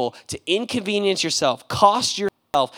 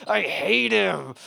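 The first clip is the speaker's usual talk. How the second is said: ordinary talk, nothing marked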